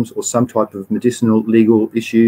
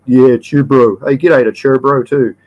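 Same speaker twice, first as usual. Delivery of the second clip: imitating a Kiwi Maori mate's accent, louder; playful Maori-accented "chew bro", a bit louder; 3 semitones higher, 4 dB louder than usual. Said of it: "Maori friends... they just love it".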